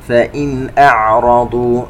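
slow, melodic tajweed chant; measured Quranic recitation. Shown as a demonstration. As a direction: recite slowly with held nasal sounds. Recites verse segment.

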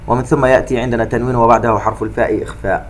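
ordinary talk

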